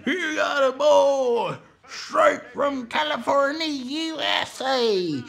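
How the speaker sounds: high, slow bellowed introduction; hammed-up announcer. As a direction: stretch every word like a country emcee hyping the act. Emcee introducing the band.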